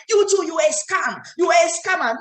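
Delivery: high-pitched mock angry accusation; angry retort. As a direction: act out an unprofessional, indignant comeback. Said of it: "don't respond in an angry manner".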